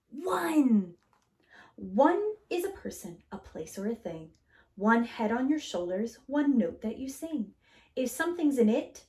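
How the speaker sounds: put-on gentle read-aloud to children; storybook reading. A narrator's reading. Teacher reading a picture book to kids, gentle and lively. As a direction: read it warmly, like story time.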